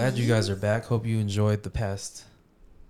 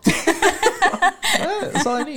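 high, loud laughing talk; 7 dB louder than usual. Real, not acted. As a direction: crack up while talking. Laughing about Martin singing.

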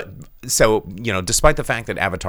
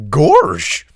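Goofy gorsh; 6 semitones higher, 9 dB louder than usual. Retold or imitated. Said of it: "says Rach Bo".

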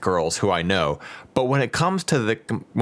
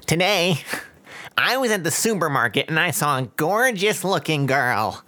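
lightly voiced nerd, a bit higher; 6 semitones higher than usual. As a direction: read it like a shy nerd. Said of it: "should I do this in a nerd voice".

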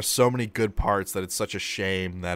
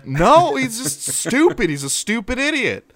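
mocking; 12 semitones higher, 6 dB louder than usual. Real, not acted. Mocking the character's stupidity.

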